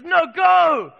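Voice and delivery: loud, high command to go; a preacher's full-voiced cry of go; commanding shout. Jesus commanding disciples, preached.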